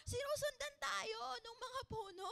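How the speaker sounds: put-on excited child. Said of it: "So siya sumigaw siya".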